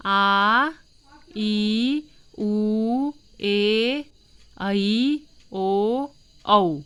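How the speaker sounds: आ ई उ ए ऐ ओ औ each held long and loud; long vowel drill; long vowels demo. Shown as a demonstration. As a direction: stretch every vowel आ, ई, उ, ए, ऐ, ओ, औ to double length. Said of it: "ह्रस्व स्वर से दोगुना समय लगता है".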